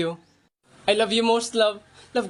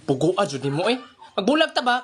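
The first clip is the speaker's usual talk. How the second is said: ordinary talk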